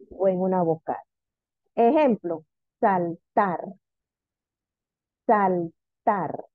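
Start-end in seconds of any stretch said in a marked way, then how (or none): 2.8-3.6 saltar in two parts, weight on TAR; teacher sounding out saltar, stressed at the end; stress on last syllable. Shown as a demonstration. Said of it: "Tiene acento en la última sílaba". 5.2-6.4 Saltar split as sal, TAR; stress on last syllable. Shown as a demonstration. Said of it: "Tiene acento en la última sílaba".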